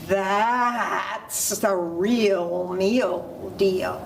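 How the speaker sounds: very slow, stretched words; long, relished storybook delivery; savouring sales pitch. Acted. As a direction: draw every word right out. Goat sells his bigger brother.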